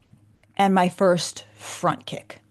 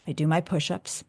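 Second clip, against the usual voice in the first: ordinary talk, nothing marked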